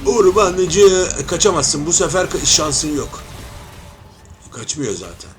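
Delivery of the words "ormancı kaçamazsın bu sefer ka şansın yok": loud taunt. Real, not acted. Taunting the fleeing jungler.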